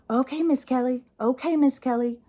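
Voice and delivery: high, singsong agent parody; mock call-center agent. Phone agent placating an annoyed customer, mocking imitation.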